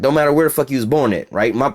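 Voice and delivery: ordinary talk, nothing marked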